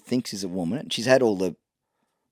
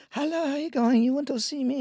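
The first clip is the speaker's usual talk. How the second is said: high mock Thai lady boy voice; 13 semitones higher, 3 dB quieter than usual.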